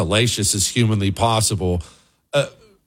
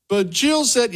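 put-on higher, pleading old man; mock Biden whine; 13 semitones higher, slower than usual. Host voicing Biden pleading, higher and whiny. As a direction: whine like a scolded grandpa.

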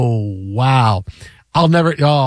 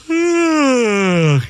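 big mock yawn; 7 semitones higher than usual. Husband mimics yawning at his wife, hammed-up yawn. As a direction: stretch out a huge theatrical yawn. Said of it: "just went".

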